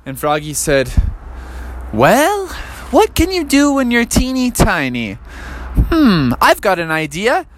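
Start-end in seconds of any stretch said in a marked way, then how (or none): 1.9-7.5 higher squeaky frog with an idea; tiny frog scheming. Acted. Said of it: "so his voice was so high".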